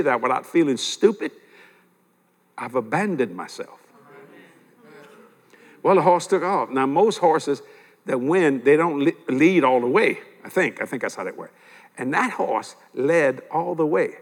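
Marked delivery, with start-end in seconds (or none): none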